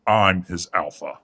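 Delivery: loud, hard assertion of rank; angry claim. Acted. Father claims authority over Chester, loud and angry. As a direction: slam it down.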